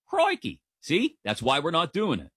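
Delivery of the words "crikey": bad Australian accent. Shown as a demonstration. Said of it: "no Australian accent. / See?".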